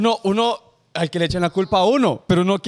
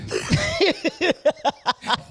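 carcajada aguda y sostenida; 11 semitones higher than usual. Real, not acted. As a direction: suelta una risa larga y aguda. Risa tras la anécdota del alero. (risa a carcajadas)

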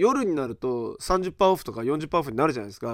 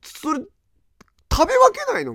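much higher, rising disbelief; can't believe he mixes them; incredulous question; 4 dB louder than usual. Real, not acted. Asking the chef why the sauces aren't eaten separately, high disbelief.

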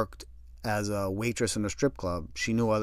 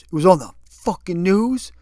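louder, higher furious disbelief; incredulous outburst; 7 semitones higher, 9 dB louder than usual. Retold or imitated. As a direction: explode in disbelief. Kev incredulous, angry.

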